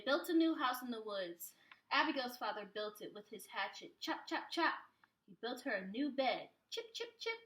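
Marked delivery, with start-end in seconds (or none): 4.0-4.7 higher, punchy chop chop chop; rhythmic, punched chopping beat; chopping sounds. A narrator's reading. Father chopping with hatchet. 6.7-7.3 much higher, tiny chip chip chip; high, delicate carving taps; light chipping sounds. A narrator's reading. Father carving bed.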